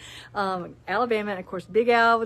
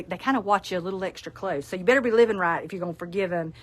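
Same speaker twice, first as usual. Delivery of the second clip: ordinary talk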